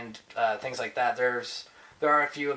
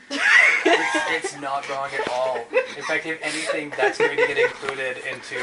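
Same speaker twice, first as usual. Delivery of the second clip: laughing; 2 semitones higher, 6 dB louder than usual. Real, not acted. Laughing at RoboCop suggestion.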